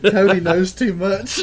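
slow, high, laughing tease; slow teasing laugh. Real, not acted. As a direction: drag it out, laughing.

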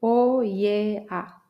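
O I E A drawn out; O, I, E, A one by one; slow vowel spelling. Shown as a demonstration. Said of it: "La segunda he pronunciado sociedad".

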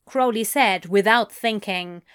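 ordinary delivery

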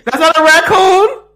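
high, loud laughing question; laughing disbelief. Real, not acted.

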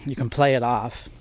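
normal talking voice

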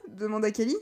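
ordinary delivery

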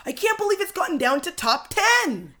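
high-pitched crowd disbelief; mimicked exclaiming. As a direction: exclaim like an overexcited onlooker. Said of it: "They're exclaiming things".

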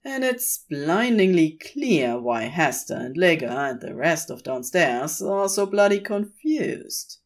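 ordinary delivery

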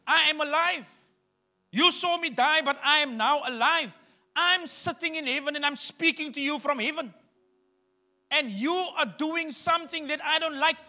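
high, loud, proclaiming voice of Jesus; Jesus confronting Saul from heaven, loud and high; voicing Jesus. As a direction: declare it like the risen Lord. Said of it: "He says".

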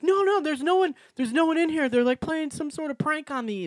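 pleading hysterically like in a nightmare; imitating crying plea. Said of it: "crying in my dream".

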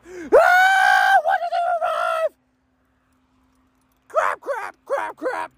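very high screaming crap crap; real screaming. Real, not acted. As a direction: scream in fanboy shock. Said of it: "I am sorry for screaming".

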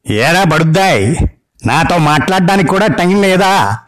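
put-on an old granny demanding attention; scolding grandma. Grandma on the phone orders the wife, scolding old-woman voice. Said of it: "బామ్మగారి గొంతు".

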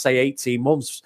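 ordinary talk

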